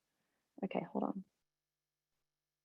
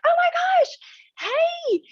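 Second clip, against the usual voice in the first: a sudden ecstatic squeal, far higher and louder; overjoyed cry; 4 dB louder, faster than usual. Retold or imitated. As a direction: cry out in pure joy at finally arriving. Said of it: "And I was like".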